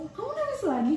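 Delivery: mock exasperation. Girlfriend corrects boyfriend's reaction to her crying, high mock exasperation. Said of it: "kamu nggak gitu".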